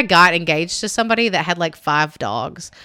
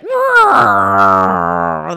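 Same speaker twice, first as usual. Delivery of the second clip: long, loud mock growl; imitated cat growl; 7 dB louder than usual. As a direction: roll out a kitten's angry growl. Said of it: "he growled at him".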